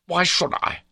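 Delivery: high, loud, defiant question; angry challenge. A narrator's reading. Baronet challenging his confidant, angry. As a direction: throw it back indignantly. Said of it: "his anger had dropped from him".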